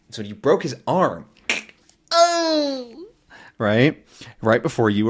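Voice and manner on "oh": mock crying oh. Gorilla crying sound.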